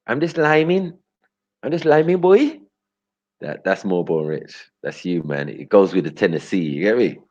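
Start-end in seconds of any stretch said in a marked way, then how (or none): 0.1-2.6 mock Trinidadian accent. Teasing Born Rich in a Trinidadian accent. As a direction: play a laid-back Trinidadian liming. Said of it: "like a Bajan or Trinidadian".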